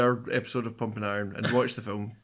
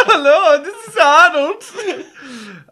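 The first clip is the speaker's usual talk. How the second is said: a hammed-up Austrian Arnie accent, much higher and louder, slow and laughing; Arnie impression; 16 dB louder, much slower than usual. Podcaster retries the Arnold phone voice, laughing through a bad Austrian accent. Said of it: "worst Arnie accent you've ever done".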